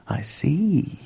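whispered, drawn out slowly; slow, hushed. Acted. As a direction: let it sink in, whispered. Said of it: "said George slowly".

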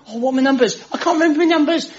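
high-pitched fretful wail; hammed-up helpless whine; flustered lottery woman. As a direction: imitate a forgetful customer in a panicky high voice. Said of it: "this woman with all her lottery tickets".

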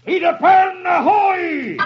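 high, long-carried call from aloft; high hailing cry; lookout's shout. Acted. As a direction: sing out the sighting like a ship's lookout. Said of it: "the lookout shouts".

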